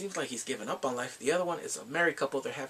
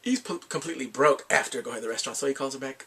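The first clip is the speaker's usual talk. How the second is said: normal talking voice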